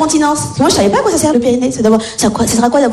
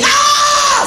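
high, long pretend yell; hammed-up pain shriek; faked scream of pain. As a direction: cry out as if it hurts, as a prank. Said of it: "ça vous fait mal".

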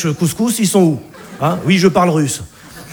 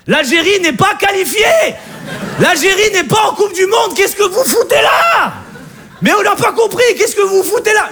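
mock outrage; 9 semitones higher than usual. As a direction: fake fury at fans who shouldn't be there. Outraged rant about Algerian fans. (indignation moqueuse, aiguë et forte)

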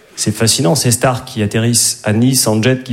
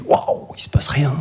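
hushed, slow, flat letdown; whispered anticlimax; deadpan whisper; 5 dB quieter than usual. Retold or imitated. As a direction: breathe it out like nothing happens. Disappointed whisper about nightclub.

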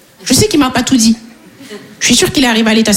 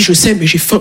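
low, deadpan hungry plea; deadpan and deep, unbothered; shameless instructor; 7 semitones lower than usual. Retold or imitated. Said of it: "Il a dit". (moniteur sans gêne, voix grave)

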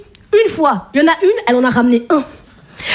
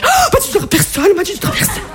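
gossip itching to tell; 3 semitones higher, faster than usual. Retold or imitated.

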